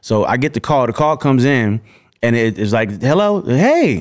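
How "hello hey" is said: breathy-free high gentle greeting, slowed right down. Retold or imitated. [name] on the phone.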